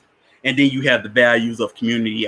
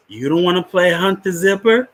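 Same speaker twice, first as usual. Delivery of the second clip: teasing, higher question rising at the end; higher coaxing voice; 7 semitones higher than usual. Retold or imitated. Counselor coaxing Bernard, higher and teasing. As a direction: lure the boy into the game.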